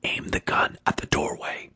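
very soft, voiceless whisper; barely audible hushed command; whispered order. A narrator's reading. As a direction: whisper the instruction carefully. Whispered orders near a gunman.

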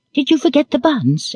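put-on high child voice, anxious; anxious question. A narrator's reading. Little girl checking on the tea buns, anxious. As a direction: ask it high, with childish worry. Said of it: "inquired Betty anxiously".

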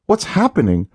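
asking in rising panic; scared wife asks what's happening; panicked. A narrator's reading. Leah panics at news.

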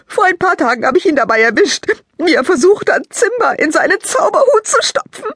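put-on high, loud girl's voice thick with sobs; sobbing. Lila explains her brother's magic kit to Maike, sobbing. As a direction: sob through it like a heartbroken child.

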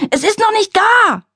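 high, loud panicked yell; bellowing mother. A narrator's reading. Mother in labour bellowing at her family, high and loud. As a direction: scream it out, the baby must not come yet. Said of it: "brüllte meine Mutter unentwegt".

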